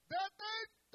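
loud, high command from heaven; commanding shout. Acted. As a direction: stop him dead with a shout. God stops Abraham's sacrifice.

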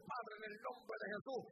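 a loud urgent call to God; loud prayer. Retold or imitated. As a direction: cry out the prayer as he charges. Pastor prays as man charges.

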